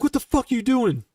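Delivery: an imitated furious question; mock angry yell. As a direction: chew her out over the phone. Yelling at soldier on phone.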